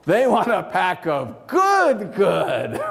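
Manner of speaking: big, hammed-up sales relish; relishing enthusiasm. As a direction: stretch out both "good"s with gusto. Gleeful contrast with plain good.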